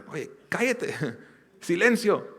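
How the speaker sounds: mock scolding, pitched high, with a laugh; shushing them. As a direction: hush the beggars half-laughing. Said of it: "a gritarle".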